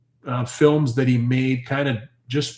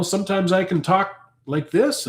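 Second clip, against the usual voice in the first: high octave; 8 semitones higher than usual. Shown as a demonstration. Streamer showing chat the voice's range, high-pitched. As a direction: talk in a raised, higher octave. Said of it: "change the octaves in my voice".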